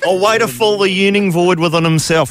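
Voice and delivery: put-on echoing the film line in a laughing Kiwi accent; half-laughing New Zealand accent; mock New Zealand accent. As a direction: repeat it with a giggly New Zealand twang. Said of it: "that's almost good".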